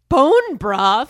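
mock disbelief. Mocking her own quiz answer.

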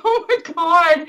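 high, drawn-out retold awe; overwhelmed. Fan hearing he is happy for her, overwhelmed. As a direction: draw it out, lightly imitating your own amazement. Overwhelmed by his kind words.

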